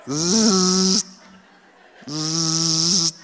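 imitated machine zzz; mouth-made copier hum; copier buzz. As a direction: buzz like a photocopier.

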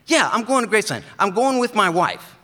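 high, loud, eager friend's voice; Brian's eager voice. Retold or imitated. Brian greets his mail carrier, high and loud. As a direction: pitch it way up and call out to your old buddy. Brian excited about Graceland.

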